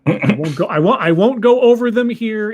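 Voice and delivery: usual speaking voice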